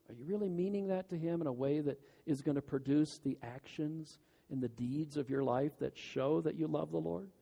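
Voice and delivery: usual speaking voice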